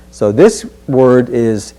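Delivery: ordinary talk, nothing marked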